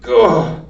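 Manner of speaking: loud startled gah. A narrator's reading. Mig reacting to Cook's order, loud startled gah. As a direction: blurt it out loud. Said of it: "said Mig".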